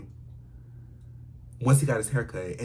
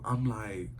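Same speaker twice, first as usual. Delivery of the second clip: ordinary talk, nothing marked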